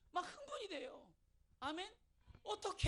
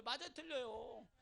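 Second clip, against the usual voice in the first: ordinary talk, nothing marked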